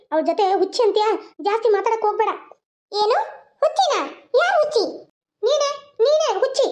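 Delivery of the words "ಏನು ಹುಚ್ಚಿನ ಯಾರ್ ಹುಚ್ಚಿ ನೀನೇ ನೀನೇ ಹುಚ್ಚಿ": offended grandma. Acted. Grandma offended at being called mad.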